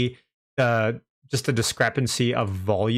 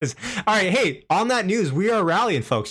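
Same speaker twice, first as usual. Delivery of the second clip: excited; 8 semitones higher than usual. Real, not acted. Stock rebounds, streamer hypes.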